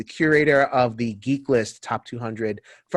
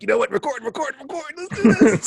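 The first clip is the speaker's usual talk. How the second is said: excited; 12 semitones higher, 3 dB louder than usual. Retold or imitated. Retelling his excited call to record.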